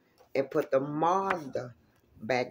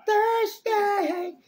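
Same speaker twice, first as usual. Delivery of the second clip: high, loud burst of realisation; sudden high shout of a single word; surprised realisation; 5 dB louder than usual. Real, not acted. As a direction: it just clicked, blurt it out high. Realising the date.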